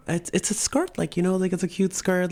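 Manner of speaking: acted-out meek justification; sheepish defence. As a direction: plead your case in a high voice. Defending skirt to critical teacher.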